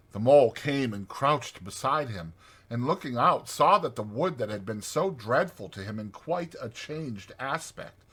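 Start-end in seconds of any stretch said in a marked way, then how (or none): none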